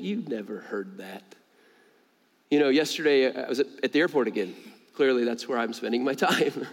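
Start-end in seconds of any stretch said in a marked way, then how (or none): 0.0-1.3 slow, low, much softer insinuation; enemy's doubting voice. Acted. The enemy speaking doubt to a believer, quiet insinuation. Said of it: "The third voice is the enemy saying".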